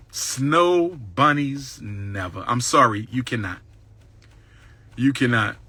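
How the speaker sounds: slow, low, drawn-out verdict; mock solemn refusal. Real, not acted. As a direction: hand down the ruling slowly and low. Refusing the snow bunny.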